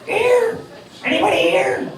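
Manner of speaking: high, lisping boxer voice, way up in pitch; squeaky Mike Tyson calling out to the crowd; Mike Tyson impression. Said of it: "and here's Mike".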